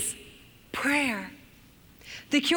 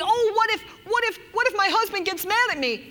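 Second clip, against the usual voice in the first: a hammed-up anxious worrier; anxious fretting; 7 semitones higher than usual. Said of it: "Rather than saying".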